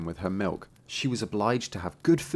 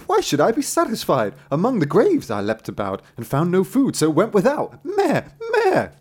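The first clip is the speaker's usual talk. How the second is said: high, loud indignant goat; complaining goat; 9 semitones higher, 6 dB louder than usual. Acted. Goat answers the tailor in the stable, high bleating complaint.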